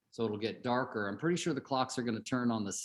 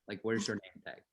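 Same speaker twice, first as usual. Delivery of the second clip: put-on softer Lebanese-accented repeat, punching "where's" in "where's your name tag"; 3 semitones lower, 5 dB quieter than usual. Uncle with a Lebanese accent repeating his question to his nephew, softer. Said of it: "So they had, like, this accent".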